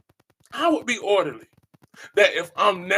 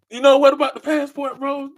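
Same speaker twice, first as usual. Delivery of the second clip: higher, louder mock question; imitating his bro; 8 semitones higher, 7 dB louder than usual. Echoing his bro's passport question, higher and louder. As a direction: toss it back the way bro said it.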